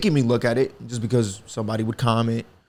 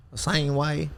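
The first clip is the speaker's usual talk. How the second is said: softer, imitated Southern twang; Southern accent; 2 semitones higher, 7 dB quieter, slower than usual. Shown as a demonstration. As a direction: mimic a Southern accent on the vowels. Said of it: "it sounded very Southern".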